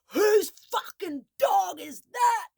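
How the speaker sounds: high, drawn-out angry yell; screaming. Retold or imitated. Angry man charging at three dog owners in the park, screaming. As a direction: imitate the man's screaming, loud and slow. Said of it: "He was screaming".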